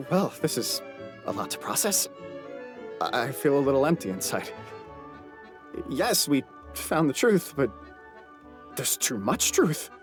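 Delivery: halting, subdued and hollow; overwhelmed, deflated. Acted. Overwhelmed on learning an empty truth.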